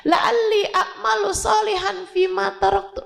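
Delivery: the verse "La'alli a'malu sholihan fima taraktu" cried high as a begging dying man; pleading prayer. Acted. Dying man quotes the verse to God, high pleading. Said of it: "dia akan bilang".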